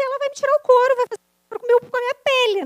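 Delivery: high, loud mock panic; picture mom's reaction with dramatic alarm; hammed-up fear of a furious mother; dramatic dread. Imagining mother's fury.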